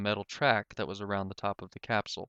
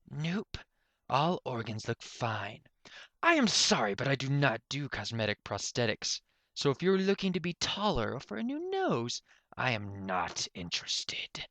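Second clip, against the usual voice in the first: a hint of a teasing inventor, some lines higher; lightly voiced mocking professor; 4 semitones higher than usual. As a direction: toy with her while guessing. Professor dismisses visitor.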